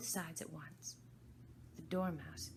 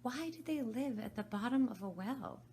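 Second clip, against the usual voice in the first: repeated question; 8 semitones higher, 5 dB louder than usual. Acted. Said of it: "repeated her question".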